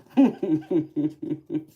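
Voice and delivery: real high, loud giggle; giggling laugh. Real, not acted. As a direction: burst into giggles, tailing off. Laughing at repeated spicy joke.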